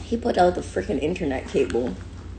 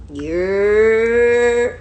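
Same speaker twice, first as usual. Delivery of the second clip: long, rising holler; drawn-out street call; New York "yerr" call; 3 semitones higher, 6 dB louder than usual. Shown as a demonstration. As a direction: stretch the call out and let it swell. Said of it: "learn how to year too".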